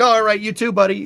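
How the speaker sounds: mock New York reply; New Yorker reply. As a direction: answer back like a cheery New Yorker. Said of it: "It's like".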